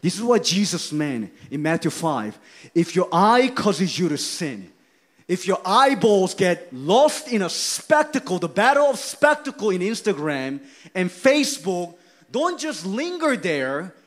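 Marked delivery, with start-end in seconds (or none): 2.8-14.0 loud, high preaching that builds to shouts; fiery preaching. Acted. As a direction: press the sinner hard from the pulpit.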